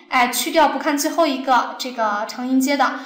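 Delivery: ordinary talk, nothing marked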